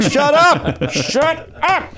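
high-pitched heckle; hammed-up outburst at a corny announcer; mock exasperated yell. Host yells back at the announcer's catchphrase, mock exasperated.

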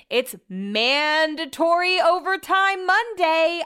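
high, slow, stretched singsong announcement; mock announcement. Telling listeners about Monday overtime, mock announcer. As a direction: proclaim it slowly in a high voice.

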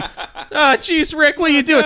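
very high, loud fretting cartoon kid; hammed-up anxious sidekick protest; Morty. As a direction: squeal it high and flustered. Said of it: "Rick and Morty guy fucking bit".

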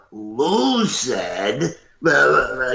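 drawn-out, loud gruff mimicry; friend's gruff imitation. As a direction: stretch it out like a friend mocking a gruff voice. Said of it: "a loud, gruff voice ... they used to imitate me".

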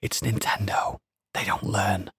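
hushed whisper into the ear. Shown as a demonstration. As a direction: lean in and whisper it. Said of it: "let me just whisper into your ear".

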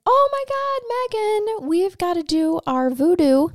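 high, eager call to co-host; excited call. Real, not acted. Excited opening cry to co-host.

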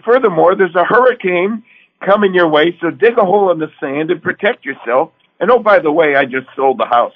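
spring the surprise on her; playful announcement. Retold or imitated. Husband to wife about the hurricane and the house: high, loud, playful.

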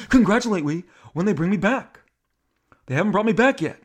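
indignant. Acted. Shatner says wait until he returns, indignant. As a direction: imitate an exasperated star, voice lifted. Shatner's comeback acted again.

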